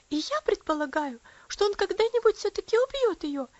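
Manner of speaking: high loud tearful outburst; upset child. A narrator's reading. Lucy tells her mother about the accident, high and loud. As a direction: pour it all out, near tears.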